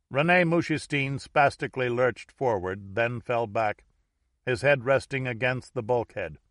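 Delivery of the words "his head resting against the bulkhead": usual speaking voice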